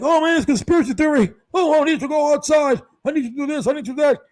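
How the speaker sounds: high, loud whiny mocking protester. Mocking anti-maskers, a loud whiny protester. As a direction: ham up the complaining anti-masker. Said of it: "And you're like".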